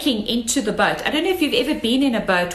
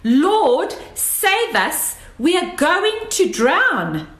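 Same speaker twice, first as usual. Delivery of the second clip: panicked cry; 6 semitones higher, slower than usual. Retold or imitated. Disciples crying out to Jesus in the storm, high panicked shout. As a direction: cry out like sailors sinking in a storm. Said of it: "maybe they were even a little bit high-pitched".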